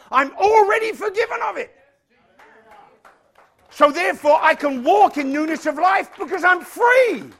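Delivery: high, loud preacher's delivery; full-force preaching. Real, not acted. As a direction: drive it home to the congregation.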